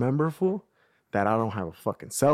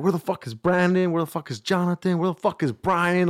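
higher-pitched retold demand, repeated like a list; exasperated searching; 6 semitones higher than usual. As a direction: act out hunting for lost friends. Acting his search for missing friends.

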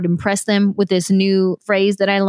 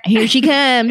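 playful imitated drawl, slightly raised; drawling mimicry of the other speaker; put-on drawl; 4 semitones higher, 4 dB louder, slower than usual. Shown as a demonstration. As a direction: slip into the listener's drawl. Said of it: "Like, you have a drawl".

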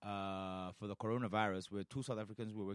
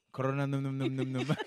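playful chomping chant; 3 semitones higher, 8 dB louder than usual. Pretend. Jokingly names virus eating.

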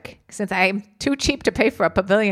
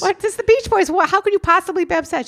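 high, louder baffled questioning; incredulous; 11 semitones higher, 5 dB louder than usual. Retold or imitated. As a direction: ask in high disbelief. Retold questioning of crying stranger.

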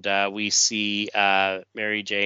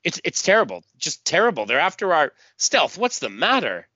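distraught; 7 semitones higher, 3 dB louder than usual. Acted. Telepath distraught.

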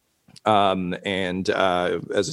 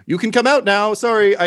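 high, louder summons; calling out; 12 semitones higher, 6 dB louder than usual. Acted. Host calls his hidden friend out, high and apologetic. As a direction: raise the voice to reach someone hidden.

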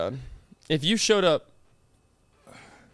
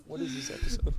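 high breathy repeat; 7 semitones higher, 9 dB quieter than usual. Pretend. Repeated in odd high whisper.

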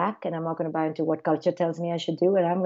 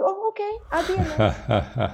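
put-on high childlike agreement breaking into a laugh; child agreeing to play nurse, then laughing; little kid voice; 11 semitones higher than usual. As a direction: play the toddler cheerfully giving in.

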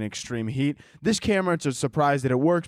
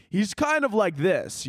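sportscaster voice; 6 semitones higher, slower than usual. Shown as a demonstration. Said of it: "what my internal monologue sounds like".

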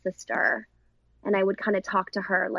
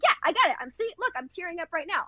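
put-on eager young actress; 10 semitones higher, faster than usual. Child actress proudly shows the director her tears, in a high keen kid voice. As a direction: imitate the child proudly tearing up. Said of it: "She's like".

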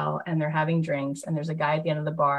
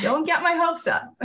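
high, laughing through the words; 12 semitones higher, 4 dB louder than usual. Retold or imitated. Host answering her producer's teasing, laughing. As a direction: giggle out the denial. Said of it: "I'm like".

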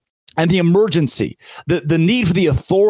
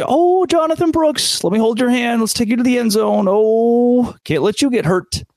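mock doting referee; 8 semitones higher than usual. Host mocks referees babying the running back, high cooing voice. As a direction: coo over the player like a nanny. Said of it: "the referees are".

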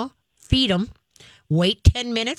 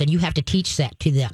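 usual speaking voice

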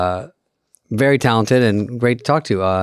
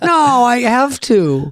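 imitating mom; 11 semitones higher, much slower than usual.

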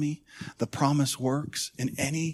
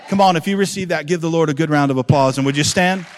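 loud, fast rallying call; rousing preaching; 2 semitones higher, 11 dB louder, faster than usual. Acted. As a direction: whip the congregation into applause. Preacher rallies for applause.

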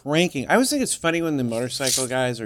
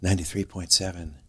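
radio DJ voice; 8 semitones lower, 7 dB quieter, slower than usual. Retold or imitated. Reciting radio call letters.

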